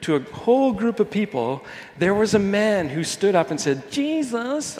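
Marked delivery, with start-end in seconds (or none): 3.9-4.8 high, whiny plea. Acted. Man interrupting Jesus from the crowd, whiny. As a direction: whine it out in a much higher pitch. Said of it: "I'll say it in a whiny voice".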